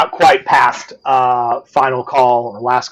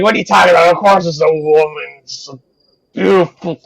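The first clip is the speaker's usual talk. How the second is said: put-on mild drunk insistence; 4 semitones higher than usual. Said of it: "And he's like".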